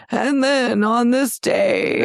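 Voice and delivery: put-on slow shaky elderly storyteller; old person voice. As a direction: lips tucked in, old lady talk. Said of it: "like the old person voice".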